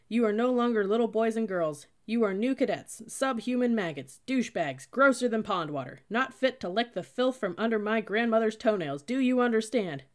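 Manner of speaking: loud, high barked insults; drill-instructor tirade. A narrator's reading. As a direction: hurl it at the recruits. Cadet insulting recruits at volume.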